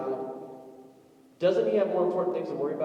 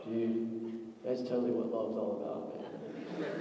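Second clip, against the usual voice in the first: low, soft laid-back stoner drawl; dazed guy watching the girls; stoner dude; 7 semitones lower, 8 dB quieter, slower than usual. Retold or imitated. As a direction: mellow hippie guy, half-laughing.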